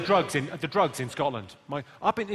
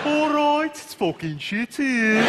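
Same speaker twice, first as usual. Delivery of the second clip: slow, high Brummie moan; stretched Brummie vowels; Birmingham accent; 8 semitones higher, 5 dB louder, much slower than usual. Shown as a demonstration. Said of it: "phrase for Birmingham".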